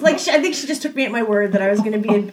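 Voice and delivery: normal talking voice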